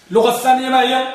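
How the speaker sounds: ordinary talk, nothing marked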